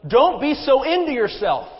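high, loud, heated reproach; almost shouting. A narrator's reading. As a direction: scold just short of a shout. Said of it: "Now Sarah was almost shouting".

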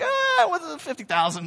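high, breezy price drop; salesman quote. Retold or imitated. Car salesman casually quoting a price to dad, high and breezy. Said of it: "He's like".

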